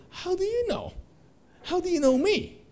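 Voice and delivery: he can't believe they know him; amazed disbelief. Retold or imitated. Son amazed he was picked.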